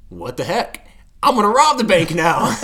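high, loud gleeful laugh-through. Pretend. Acting out robbing the bank.

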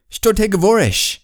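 foreign-speaking worker. Acted. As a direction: shout a puzzled foreign question, playfully acted. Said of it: "some other guy says".

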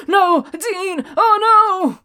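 high, pleading cry of a brother losing his brother; Sam's despairing cry. Retold or imitated. Sam crying out as Dean dies, high and pleading. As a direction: wail it as if he's watching his brother die. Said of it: "Sam is left being like".

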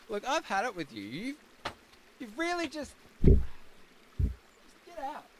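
high, soft fed-up dismissal; throwing him out. Acted. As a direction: coldly show the guest the door. Host throws out guest Paul.

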